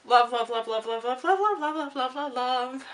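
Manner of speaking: a rapid, gushing chant of love. Real, not acted. Summing up a five-star read for viewers, gushing. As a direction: pour out the love, rising.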